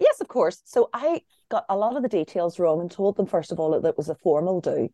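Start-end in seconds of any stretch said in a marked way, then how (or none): 0.0-0.6 eager yes. Retold or imitated. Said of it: "they were like".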